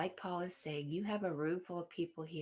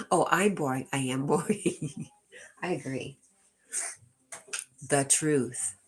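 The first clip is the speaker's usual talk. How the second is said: mock self-deprecation; 2 semitones lower, 6 dB louder than usual. Mock agreeing she's boring too.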